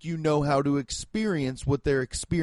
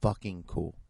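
lower, softer, slow reverent murmur; quiet awe; 6 semitones lower, 6 dB quieter than usual. Retold or imitated. Thinking of grandparents arriving by boat, quiet awe. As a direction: say it under your breath, genuinely moved. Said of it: "I was like".

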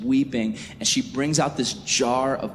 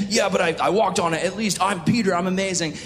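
high-pitched bragging comeback; hammed-up cocky self-defence; Peter, defensive; 6 semitones higher, faster than usual. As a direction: protest proudly, voice raised in pitch. Acted defensive, boasting Peter.

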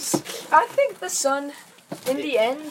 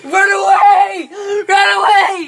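higher, much louder, slow yell; panicked shout; 8 semitones higher, 11 dB louder, slower than usual. Pretend. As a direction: yell the warning as you flee. Fleeing pretend monster.

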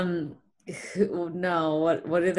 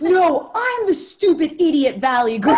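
mock dramatic; 8 semitones higher, 8 dB louder than usual. Mock self-deprecating bit.

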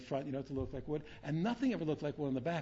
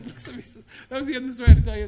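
ordinary talk, nothing marked

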